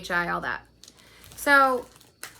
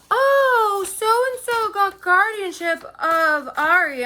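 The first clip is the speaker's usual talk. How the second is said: high, loud, slow mock-surprised friend; drawn-out fake surprise, mocking; mock shocked friend; 11 semitones higher, 6 dB louder, much slower than usual. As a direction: ham up her fake shock, much higher and louder. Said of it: "the fucking tone of her voice how un-, like, fucking shocked".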